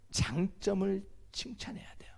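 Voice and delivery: a hushed key phrase; whispered point. Real, not acted. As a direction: whisper the lesson home.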